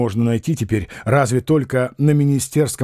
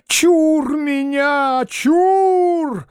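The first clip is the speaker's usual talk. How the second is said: very high, slow, operatic cry; theatrical operatic exclamation, much higher; 13 semitones higher, much slower than usual. A narrator's reading. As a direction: ward off evil like an opera bass in the last act. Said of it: "воскликнул он с шаляпинскими интонациями".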